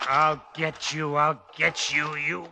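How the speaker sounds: menacing threat. Acted. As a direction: growl it slowly through the attack. Attacking the giant, vengeful.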